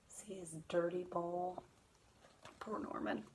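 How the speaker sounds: soft, near-whispered pity; hushed sympathetic aside. Real, not acted. Teacher shows the children the dirty bowl, hushed. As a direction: murmur it with pity for the fish.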